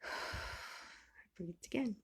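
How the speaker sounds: soft, slow breath out; deep sigh. Shown as a demonstration. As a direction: exhale before the words. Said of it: "Breathe again".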